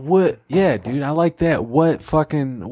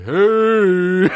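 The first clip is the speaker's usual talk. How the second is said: God voice; 4 semitones higher, 4 dB louder than usual. Acted. God voice answering prayer.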